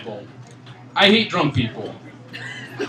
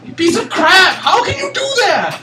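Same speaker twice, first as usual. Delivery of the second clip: higher, louder outraged yell; mock yell; 12 semitones higher, 3 dB louder than usual. Yelling at the friend over funeral ice cream, outraged. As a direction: hurl it at her in disbelief.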